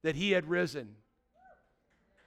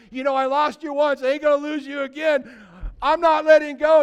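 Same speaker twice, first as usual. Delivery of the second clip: clinging plea; 6 semitones higher, 9 dB louder than usual. Retold or imitated. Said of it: "she put a death grip on Jesus".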